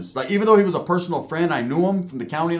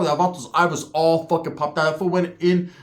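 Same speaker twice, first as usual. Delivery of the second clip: usual speaking voice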